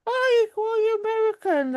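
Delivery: high-pitched mock exclamation; sing-song high imitation of someone exclaiming; mimicked surprise. Imitates people reacting to daughter's accent.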